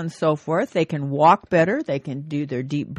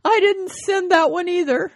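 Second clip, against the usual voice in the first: put-on old wife voice; 13 semitones higher, 4 dB louder than usual. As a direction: pipe it up sweetly like the wife. Wife Esther confesses in joke.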